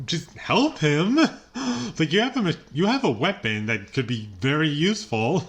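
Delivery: high, incredulous pleading at a movie character; mock exasperated plea. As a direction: protest as if yelling advice at the screen.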